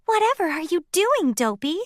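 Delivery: high, quick puzzled ask; a touch of amazement; surprised question. A narrator's reading.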